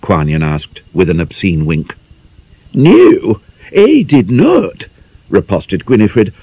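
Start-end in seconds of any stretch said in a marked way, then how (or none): 2.7-4.9 prim and affected, drawn out slowly and pitched high; a shocked lady putting on airs, slow. A narrator's reading. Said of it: "grew more affected in proportion".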